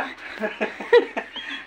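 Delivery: soft wheezy chuckling; amused laugh. Real, not acted. Healer amused at the dazed patient, chuckling. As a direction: chuckle to yourself.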